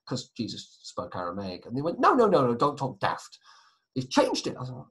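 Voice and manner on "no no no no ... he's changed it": exasperated grandparent. Retold or imitated. Grandparents arguing about the vicar.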